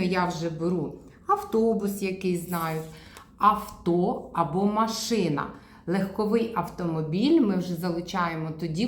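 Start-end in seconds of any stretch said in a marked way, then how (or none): 3.4-5.5 авто and машина said slowly, each word drawn out; slow clear words. Shown as a demonstration. Therapist saying the short words slowly for a child. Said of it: "Скорочуємо до авто".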